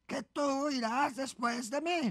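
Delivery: slow, drawn-out challenge; indignant echo question. Acted. Porcupine challenged over who goes first, indignant. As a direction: stretch each word in disbelief.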